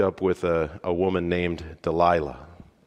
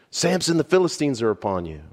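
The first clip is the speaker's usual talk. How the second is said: higher, quick call of alarm; a third false alarm, a little less forceful; 8 semitones higher, faster than usual. Acted. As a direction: rattle off the warning. Delilah calls third time.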